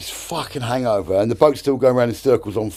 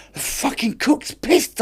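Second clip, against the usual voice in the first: much higher, slow mock-panicked report; agitated skipper; 14 semitones higher, slower than usual. Skipper phoning the boss from sea, mock alarm in high voice. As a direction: squeal it like an imitated skipper.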